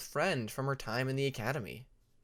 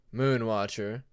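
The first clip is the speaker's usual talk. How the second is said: low, courteous father's voice; respectful; 3 semitones lower, 4 dB louder, slower than usual. Acted. Winter courteously greeting Moon, an old academy friend. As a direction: say her full name with measured respect. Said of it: "saying Moon's full name respectfully".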